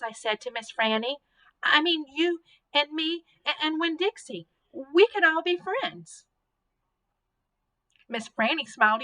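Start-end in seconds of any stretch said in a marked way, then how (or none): 1.6-6.3 put-on eager child. A narrator's reading. Child eagerly offers friendship.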